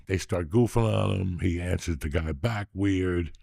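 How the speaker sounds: ordinary talk, nothing marked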